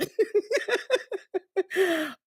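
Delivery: high uncontrollable giggles; giggling fit. Real, not acted. Cannot stop laughing.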